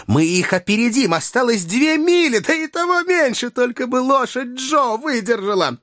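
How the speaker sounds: climbing to a very high, urgent pitch; urgent excitement. Acted. Doctor racing the balloon to rescue Joe, urgent excitement. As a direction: build from determined to desperately hopeful, pitch soaring.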